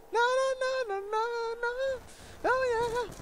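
very high squeaky no no no; mock squeak. Gamer squealing at the zombies in a high voice. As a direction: squeal it comically.

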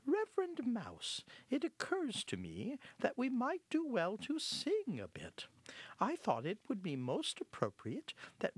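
high-pitched, polite little mouse; shy mouse. Acted. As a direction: make a modest proposal in a small high voice. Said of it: "Hortense began shyly".

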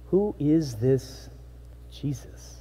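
slow, trailing into a whisper; hushed wonder. Acted. Dinner guests asking about Jesus in hushed wonder. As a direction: let the name fade to breath.

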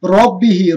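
রব্বিহি with a heavy doubled রা; recited model word. Shown as a demonstration. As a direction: say it for students to repeat. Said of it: "তো চলুন আমরা কি বলবো?". (মোটা রা-তে পাঠ)

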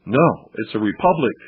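ordinary talk, nothing marked

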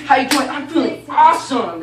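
loud, high, pumped-up exchange; booming upbeat enthusiasm; exuberant greeting. Acted. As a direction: act out a big enthusiastic hello. Re-enacts exuberant exchange with Jose.

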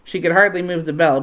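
ordinary delivery, nothing marked

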